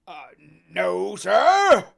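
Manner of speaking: loud, high nervous answer; Morgan answering. Acted. Morgan answers Silver, loud and nervous. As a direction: blurt it out.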